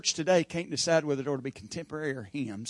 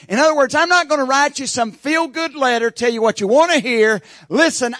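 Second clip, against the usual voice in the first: much higher and louder, pounding delivery; full-force preaching; 9 semitones higher, 11 dB louder than usual. Acted. Preacher voicing Paul's hard truth, shouting. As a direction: thunder it from the pulpit.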